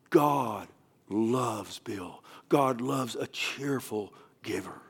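slow, hushed imitation of a tearful man; retold tearful. Crying visitor tells the pastor about giving, imitated tearful hush. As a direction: draw it out softly, as if moved. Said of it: "so he's crying".

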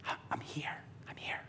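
hushed, whispered I'm here; whispered plea. Retold or imitated. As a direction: whisper it tenderly, inviting. Voicing God calling softly.

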